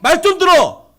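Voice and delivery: loud, high shouted rebuke; exasperated scolding. Acted. Crowd rebuking the blind man repeatedly, exasperated shouting. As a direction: yell at someone who won't stop. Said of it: "이 꾸짖다도 계속이에요".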